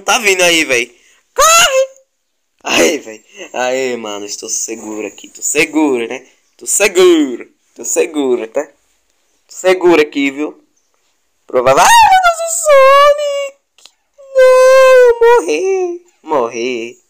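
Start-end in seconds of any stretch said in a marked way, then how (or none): 1.3-1.9 high panicked shout. Real, not acted. Fleeing an animal in game. 11.8-16.1 high shrieking panic; panicked screaming. Real, not acted. Caught by predator in game.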